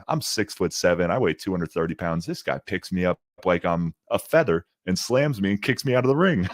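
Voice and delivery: ordinary delivery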